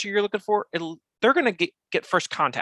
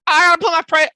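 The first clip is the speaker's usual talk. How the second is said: much higher and louder, anxious blurt; mock panic; 10 dB louder than usual. Everybody fearing they must publish prices, mock alarmed outburst. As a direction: play the owner who feels cornered. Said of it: "Everybody thinks".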